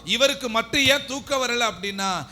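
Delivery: high, loud preacher's surge; impassioned preaching. Real, not acted. Preacher telling why Jesus could not sleep, impassioned preaching.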